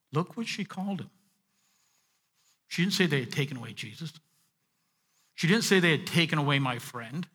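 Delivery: usual speaking voice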